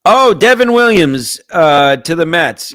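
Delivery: high, loud burst of surprise; surprised. Real, not acted. Host reacting to trade news in surprise. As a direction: blurt it out in shock.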